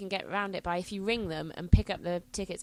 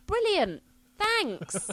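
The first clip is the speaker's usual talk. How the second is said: loud, high, biting sarcasm; sarcastic thanks; 12 semitones higher, 6 dB louder, much slower than usual. Retold or imitated. Storming off the bus, loud high sarcastic thanks. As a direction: spit out a fake thank-you. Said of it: "so all I say is".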